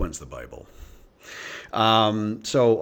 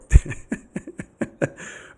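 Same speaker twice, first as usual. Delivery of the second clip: helpless chuckle, high and breaking out; amused laugh; 7 semitones higher than usual. Real, not acted. Man recalling friend's crude joke, real chuckling laugh.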